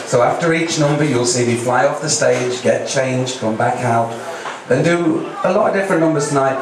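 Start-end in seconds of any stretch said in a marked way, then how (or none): none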